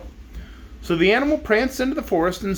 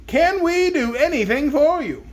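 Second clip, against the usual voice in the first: high, slow, eager offer; soldier voice; 10 semitones higher than usual. Acted. Omby Amby offering the zebra help, high and slow.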